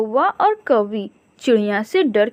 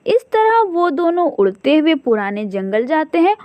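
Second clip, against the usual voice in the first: ordinary delivery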